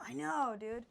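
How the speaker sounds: ordinary talk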